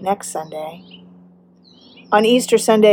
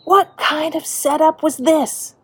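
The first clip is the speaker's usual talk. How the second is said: high, raised retold yell at God; angry yell; 8 semitones higher, 3 dB louder than usual. Preacher recalls turning anger directly at God, raised angry yell. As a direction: cry out in indignant protest, pitched well up. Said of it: "turned my anger directly at God and yelled".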